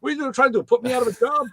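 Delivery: high, indignant New York protest; New York accent. Retold or imitated. Union mechanic accusing crew of taking his job, New York accent. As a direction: imitate an aggrieved New York union guy. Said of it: "he's got a New York accent".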